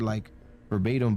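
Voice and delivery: ordinary talk, nothing marked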